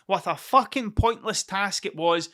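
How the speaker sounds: usual speaking voice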